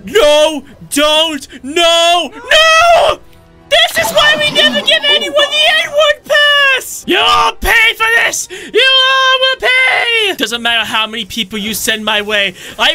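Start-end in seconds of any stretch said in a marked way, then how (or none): 0.0-10.3 high furious shouting; angry yelling. Acted. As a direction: scream vengeance at them. Enraged outburst at enemies.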